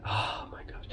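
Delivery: breathy whispered oh my gosh; whispered aside. Real, not acted. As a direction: drop to a whisper. Aside about deep fried Mars bars.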